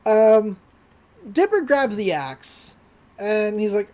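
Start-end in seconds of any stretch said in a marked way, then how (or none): none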